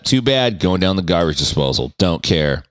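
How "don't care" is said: ordinary talk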